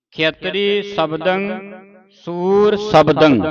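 slow ਖੇਤਰੀ ਸ਼ਬਦੰ, then ਸੂਰ ਸ਼ਬਦੰ after a pause; pause placement. Shown as a demonstration. Teacher modelling where to pause in the verse, slowly. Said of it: "ਇੱਥੇ ਵਿਸਰਾਮ ਲਾਉਣਾ ਹੈ".

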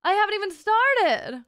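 high, loud playful threat; mock menace. Streamer teasing a scared viewer, loud mock threat. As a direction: escalate into a teasing warning.

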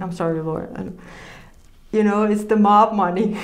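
ordinary delivery

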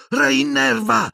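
very high, drawn-out incantation; shouted spell. A narrator's reading. Boy casting a reviving spell on his professor, shouted. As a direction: cry out the spell desperately. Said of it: "gridò".

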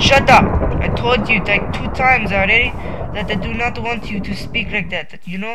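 exasperated shouting lecture, high and loud; angry scolding. Real, not acted. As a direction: scold it like a fed-up parent. Scolding the thunder loudly.

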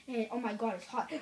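ordinary talk, nothing marked

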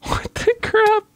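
startled squeak. Real, not acted. Startled by a mob.

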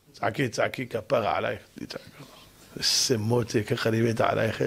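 low, soft sweet-talk; mock adoring. Husband welcoming his wife's screaming for money, mock tender. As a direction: coo at her softly, deep and blissful. Paid husband adores shouting wife.

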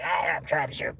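garbled high-pitched grumbling impression; mock outrage. Leftist viewer reacting to upsetting news, mock-outraged. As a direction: imitate an upset viewer ranting in a put-on voice. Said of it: "meant to upset you and anger you".

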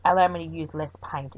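usual speaking voice

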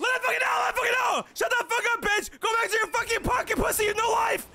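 furious shouting, high and loud; raging gamer. Real, not acted. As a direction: scream insults at full force. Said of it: "Yeah, let him out!".

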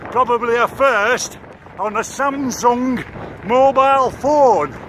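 bright mock news-report delivery; roving reporter voice. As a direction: present it like a TV field reporter. Mock TV reporter self-introduction.

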